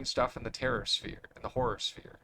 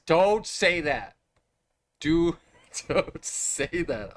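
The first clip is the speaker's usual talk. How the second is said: laughing; 3 semitones higher, 4 dB louder, much slower than usual. Real, not acted. Laughing protest at chat.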